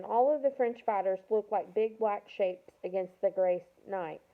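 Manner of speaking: usual speaking voice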